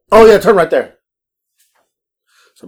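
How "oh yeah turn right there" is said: loud, high mock passenger; imitated calling out. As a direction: call the turn out suddenly, loud and pitched up. Passenger calls out a late turn.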